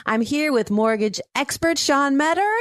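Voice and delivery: high, chipper radio welcome. Real, not acted. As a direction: greet listeners brightly, pitched well up. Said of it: "Why do I sound so chipper".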